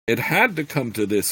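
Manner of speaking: plain reading voice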